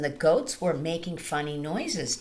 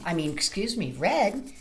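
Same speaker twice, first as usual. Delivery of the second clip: plain reading voice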